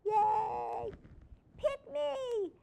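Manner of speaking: high squeaky eager animal voice; excited pet voice. Acted. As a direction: squeal like a pet begging to be chosen. Voicing eager shelter animals.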